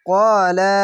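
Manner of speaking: usual speaking voice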